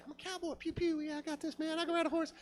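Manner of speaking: high, hushed self-hyping voice; squeaky-high and soft, pumping yourself up; mock cowboy bravado. Nervous rider talks himself into riding a horse, high quiet bravado.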